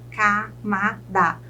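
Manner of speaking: slow "camada", split ca, ma, da; three separate beats in "camada"; syllable by syllable. Shown as a demonstration. Teacher counts three syllables for students, "camada" syllable by syllable. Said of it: "Eu chamo de trissílabas".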